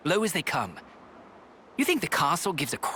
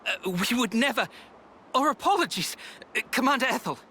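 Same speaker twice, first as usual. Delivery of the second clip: flustered apology; 9 semitones higher than usual. Acted. Soldier caught complaining by the commander, flustered apology. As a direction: backpedal hastily to a superior.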